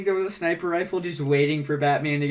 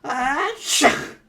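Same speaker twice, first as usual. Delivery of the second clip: big, high fake sneeze; allergic sneeze; 11 semitones higher, 6 dB louder than usual. Allergic to the cat, huge fake sneeze. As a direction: build up and burst out a sneeze. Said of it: "My allergies!".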